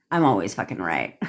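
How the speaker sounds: low, cocky certainty; matter-of-fact duh. Shown as a demonstration. Coach showing the tonality for affirmations, low and matter-of-fact. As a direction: state it low, as an obvious fact. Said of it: "See how I said it?".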